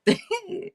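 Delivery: short, high-pitched laugh; high giggle. Real, not acted. Laughs between readings.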